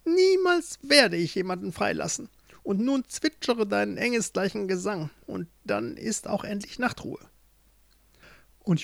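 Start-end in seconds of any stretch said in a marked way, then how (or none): 0.1-7.2 high witch voice, commanding; unrelenting witch. Acted. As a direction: refuse firmly as the witch. (unerbittliche, hohe Hexenstimme)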